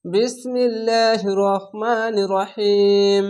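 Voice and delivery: slow, drawn-out chant of the bismillah; Quranic recitation. Shown as a demonstration. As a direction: stretch each syllable like a reciter. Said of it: "slow recitation of bismillah". (ধীরে টেনে তিলাওয়াত)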